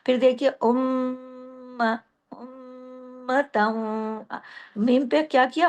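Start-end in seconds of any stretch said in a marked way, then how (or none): 0.5-4.3 slow recitation, m drawn out through the nose; long ghunna. Shown as a demonstration. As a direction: hum and hold the doubled m in उम्मतन. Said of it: "लंबा गुन्ना किया". (उम्मतन में मीम पर लंबा गुन्ना)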